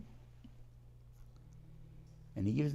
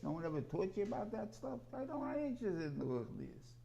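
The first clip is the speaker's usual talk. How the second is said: lightly voiced pious son, higher and mocking; 8 semitones higher, 3 dB quieter than usual. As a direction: tease him in a prim, higher voice.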